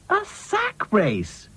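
high, slow, emphatic explanation; explaining character. A narrator's reading. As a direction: spell it out in a high voice. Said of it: "explained Dotty".

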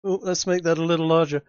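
ordinary talk, nothing marked

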